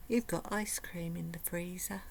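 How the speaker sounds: sly soft whisper; tempting whisper. Retold or imitated. Food noise voice tempting her toward the freezer, whispered. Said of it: "your little brain, when you have food noise, will be saying".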